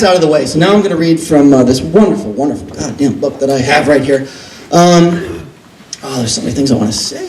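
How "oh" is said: ordinary delivery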